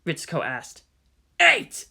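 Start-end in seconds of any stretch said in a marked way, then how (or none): 1.4-1.9 high, loud urgent call; urgent shout. Acted. Tech reporting incoming enemies, urgent shout. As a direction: call the number out sharply. Tense battle command room.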